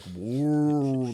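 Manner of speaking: long, ponderous mock whale moan; whale call imitation. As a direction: draw out one swelling whale-like note. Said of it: "their language is so slow and ponderous".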